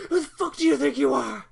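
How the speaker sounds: loud, high furious rant; imitating an enraged father yelling; mock angry villain. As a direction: yell it like an enraged dad god. Retelling angry villain outburst.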